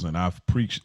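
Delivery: ordinary talk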